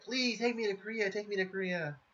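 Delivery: high-pitched mock plea; imitated begging. Daughter begging her mother to take her along, imitated pleading. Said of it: "like, begging her".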